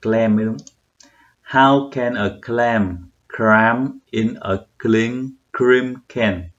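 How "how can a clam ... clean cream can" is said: slow, word-by-word tongue twister; deliberate, drawn-out practice reading; slower reading. Shown as a demonstration. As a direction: take each word carefully, one at a time. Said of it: "Đọc lại chậm hơn chút".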